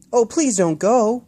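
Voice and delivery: put-on high, loud, slow child plea; pleading call. Boy calling after the flying bird, pleading loudly. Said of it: "Will called".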